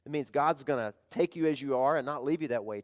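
normal talking voice

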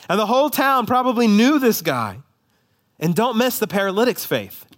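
high, driving sermon voice; full-force preaching. Acted. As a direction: preach it with rising fervour.

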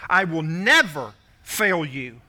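"never" drawn out and pushed harder; stress on never. Shown as a demonstration. Preacher underlining God's promise for listeners, "never" slowly stressed. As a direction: lean slowly on the word "never". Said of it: "underline the word never".

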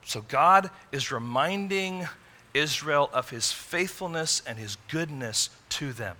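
normal talking voice

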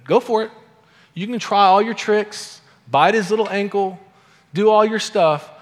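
loud, high-pitched dare; defiant taunt. Acted. God's message to Satan in the garden, loud taunting dare. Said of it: "God's message in the garden to Satan".

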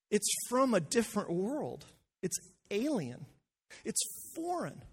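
voicing John amazed at God's foreign love; John in wonder. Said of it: "It's like John is saying".

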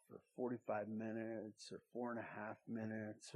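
put-on soft, low, careful hush over a sleeping baby; hushed nursery voice. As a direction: keep it down so the baby stays asleep. Hushing while laying baby down.

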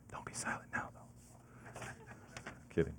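joking whisper under the breath; a soft voiceless joke to the congregation; whispered aside. Real, not acted. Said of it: "joking aside to audience".

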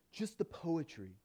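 ordinary talk

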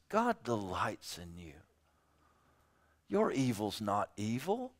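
hushed, half-whispered mock comfort; mock soothing. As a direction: coo it like false reassurance. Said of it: "that we would say".

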